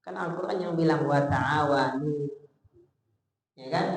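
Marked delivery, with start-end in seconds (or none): 1.1-2.3 slow, drawn-out Arabic recitation. Acted. Preacher reciting a Quran verse to the congregation, slow chant. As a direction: intone the verse reverently.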